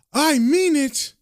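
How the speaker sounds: slow, high-pitched warning; acted insistent anger; angry and determined. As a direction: stretch it out as a last threat. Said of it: "She gets angry and determined".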